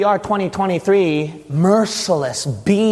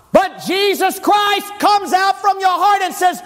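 full-force preaching; 13 semitones higher than usual. Acted. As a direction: pound out each affliction with relentless intensity.

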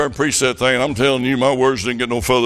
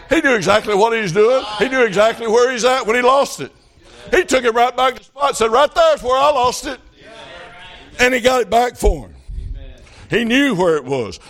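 higher, building sermon voice; rising preaching; 9 semitones higher than usual. Real, not acted. Preacher building to the church, higher pitch. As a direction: build the fervor line by line.

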